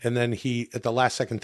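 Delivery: usual speaking voice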